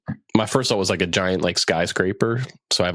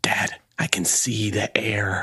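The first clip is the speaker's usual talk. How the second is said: whisper; slower than usual. Pretend. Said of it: "pet voice".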